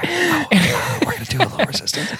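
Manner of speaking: fast, high, giddy whisper; excited. Retold or imitated. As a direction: squeal it out like an excited conspirator. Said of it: "He's so excited".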